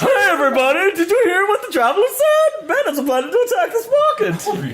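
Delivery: hammed-up alarm cry; mock town crier girl. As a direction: call out to the whole market. Voicing girl calling out.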